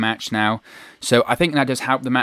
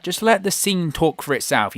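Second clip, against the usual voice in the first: normal talking voice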